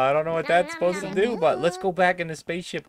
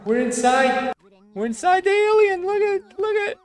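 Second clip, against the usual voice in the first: high, excited discovery; gasping at what you see; amazed; 14 semitones higher than usual. Real, not acted. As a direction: blurt it out in wonder. Discovering the alien ship interior.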